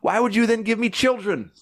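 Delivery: high, loud desperate questions building to a shout; hurling every why at God until she breaks into a yell. Retold or imitated. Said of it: "crying and I'm, like, yelling at God".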